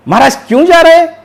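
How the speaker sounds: high, loud, quick naive question; puzzled jester. Acted. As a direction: blurt it out like a baffled fool. Jester asks why king leaves.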